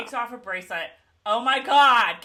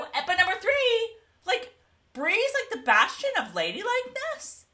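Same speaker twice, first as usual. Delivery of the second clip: much higher, louder, incredulous; incredulous rant; 12 semitones higher, 6 dB louder than usual. Real, not acted. Host rants about Brie's sudden ladylike act, incredulous. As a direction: go up high in disbelief.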